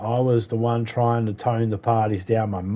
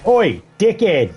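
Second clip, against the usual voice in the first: higher, louder bark; retold yell over fence; 7 semitones higher, 4 dB louder than usual. Leaning over the fence at teenagers, higher, louder shout. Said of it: "leaning over the fence going".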